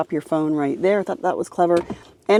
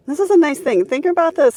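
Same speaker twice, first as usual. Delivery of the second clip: much higher, eager pitch; excited; 10 semitones higher than usual. Real, not acted. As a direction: lift the voice up, selling the point. Pointing out a favorite feature.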